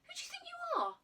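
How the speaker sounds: high, loud indignant tirade; boy scolding the girl for scaring him, outraged. Acted. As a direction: tell her off with outrage.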